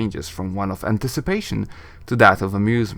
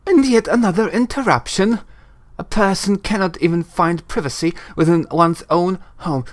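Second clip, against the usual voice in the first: higher-pitched, affronted lady voice; haughty annoyed sorceress; 6 semitones higher than usual. Acted. Shilandra warns an intruder off, haughty and annoyed. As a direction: scold the intruder with lofty irritation.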